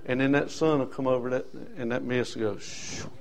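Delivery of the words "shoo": breathy shoo. Acted. Mist vanishing, breathy sound.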